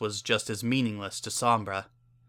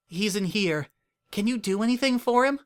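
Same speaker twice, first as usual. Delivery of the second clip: higher, gentle mare voice urging him on; a caring mare coaxing a stranger; kind Earth Pony mayor; 12 semitones higher than usual. A narrator's reading. Earth Pony mayor comforting the dying stranger, kindly higher voice.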